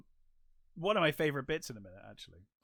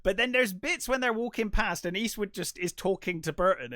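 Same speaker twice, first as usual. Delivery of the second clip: ordinary talk, nothing marked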